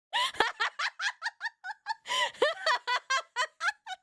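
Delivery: high, long giggling fit; laughing. Real, not acted. Streamer cracking up over a crush's age, laughing. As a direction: burst into a high run of giggles.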